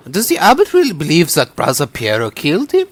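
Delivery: ordinary talk, nothing marked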